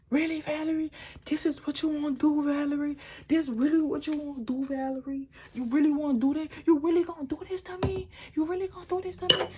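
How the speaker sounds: high-pitched, accusing mock rant; imitated freak-out. Boyfriend confronting Valerie over a deleted text, acted freaking out. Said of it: "he just freaked out".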